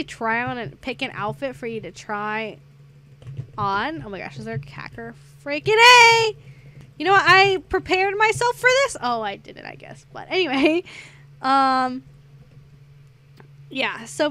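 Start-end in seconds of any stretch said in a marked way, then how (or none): 5.4-9.0 loud, high frustrated yell; real outburst. Real, not acted. Gamer yelling at a hacker mid-game, loud and high. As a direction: blurt it out at the hacker, much louder.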